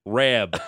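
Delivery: "Rab" for Rob, O turned to A; Buffalo-area "Rab" with an A vowel; Western New York vowel. Shown as a demonstration. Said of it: "O's are A's".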